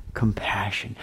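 whisper. Real, not acted. Emphatic correction in sermon.

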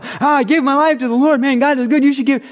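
high, loud, overflowing enthusiasm; gushing excitement. Acted. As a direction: gush like a brand-new convert. New believer gushing to people.

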